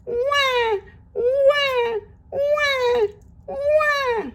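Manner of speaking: put-on very high, drawn-out baby cry; mock baby sobbing wah wah; baby wailing. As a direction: wail like a crying baby. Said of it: "you hear that sob".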